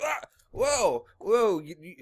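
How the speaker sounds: mock overwhelmed whoa, backing off; taken aback. Guest greeted by fawning minions, acted taken aback. Acts out his startled response.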